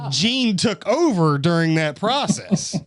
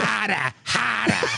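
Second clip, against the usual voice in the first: put-on Boston accent; 6 semitones higher, slower than usual. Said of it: "in a Boston accent".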